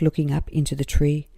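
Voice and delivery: plain reading voice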